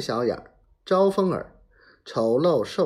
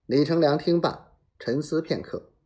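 usual speaking voice

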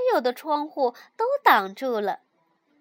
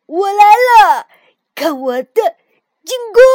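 battle cry; 6 semitones higher, 17 dB louder than usual. A narrator's reading. The frog launches his snowball attack, loud playful battle cry. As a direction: charge in yelling like a hero. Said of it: "跳跳叫道".

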